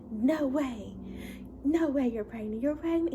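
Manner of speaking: loud, high-pitched thrilled disbelief; excited husband. Retold or imitated. Husband learning she's pregnant, thrilled and incredulous. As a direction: imitate an excited husband, voice leaping up. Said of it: "He's excited".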